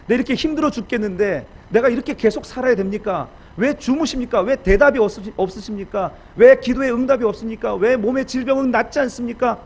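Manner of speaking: loud, high, mounting complaint; protesting to God. Acted. Weary believer demanding answers from God, loud protest. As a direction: hurl one plea after another. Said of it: "계속 하나님께 항변합니다".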